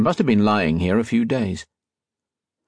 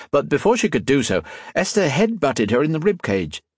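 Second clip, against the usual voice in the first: ordinary delivery, nothing marked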